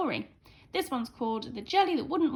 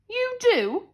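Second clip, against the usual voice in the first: boomed; 12 semitones higher, 6 dB louder than usual. A narrator's reading. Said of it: "boomed the princess".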